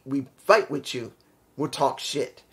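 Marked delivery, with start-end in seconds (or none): none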